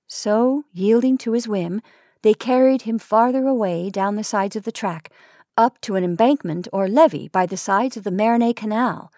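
plain reading voice

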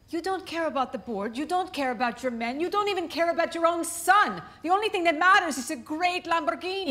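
rising angry accusation; heated family shouting. Acted. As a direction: build from hurt to shouting. Wife accuses husband, voice rising.

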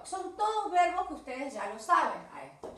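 ordinary delivery

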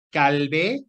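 normal talking voice

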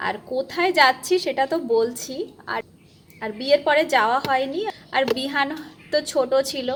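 normal talking voice

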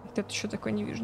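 normal talking voice

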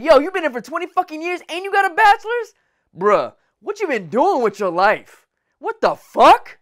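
incredulous retold reaction; retold disbelief. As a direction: act shocked at the wasted years. Retelling his shocked reaction.

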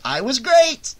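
high, self-delighted exclamation; savoring triumph. A narrator's reading. Shelley repeating Vera's praise, savoring his triumph. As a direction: relish every word proudly. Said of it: "savoring each and every one of them".

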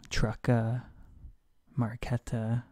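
"trucka, marqueta" with an added "uh"; mock Spanish ending. Shown as a demonstration. Teacher gives invented examples, "trucka, marqueta" ending in "uh". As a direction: tack "uh" onto the English in "trucka, marqueta". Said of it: "examples of uh at the end".

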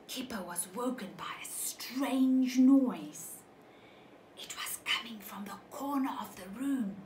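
hushed storytelling whisper. A narrator's reading. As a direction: draw the children in with a secret whisper. Spooky night moment in story.

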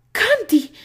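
high, loud cry; anguished. A narrator's reading. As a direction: Annie cries out seeing her friend unconscious, high. Annie angustia.

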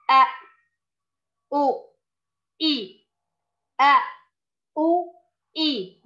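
أ، أو، إي said quick and clipped; short vowels. Shown as a demonstration. Teacher reads the short vowels quickly: short أ، أو، إي. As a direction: snap out each short vowel in turn. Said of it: "نقرأه بالحركات".

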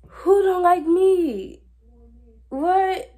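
incredulous loud high "what?"; shocked disbelief. Real, not acted. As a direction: cry out in stunned outrage. Shocked by being disliked.

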